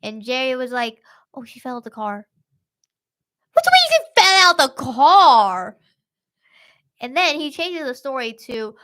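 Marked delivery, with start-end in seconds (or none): 1.3-2.3 softer, casual mock excuse; offhand excuse. Attacker explains to the couple, quiet offhand excuse. 3.5-5.8 high, loud mock disbelief; incredulous shout. Couple challenges attacker's story, high incredulous shout.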